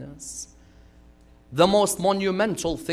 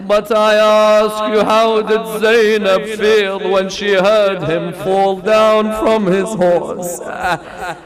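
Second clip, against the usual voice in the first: mournful preaching; 4 semitones higher, 9 dB louder than usual. Real, not acted. Preacher telling the congregation of a dream, mournful preaching. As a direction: let the grief carry, stretching key words.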